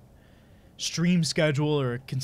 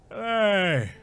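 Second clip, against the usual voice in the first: Brock impression; 7 semitones higher than usual. Shown as a demonstration. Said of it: "I was practicing his voice".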